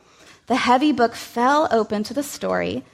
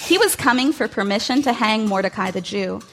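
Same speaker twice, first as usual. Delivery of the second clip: usual speaking voice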